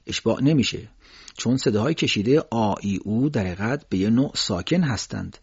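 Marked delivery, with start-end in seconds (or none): none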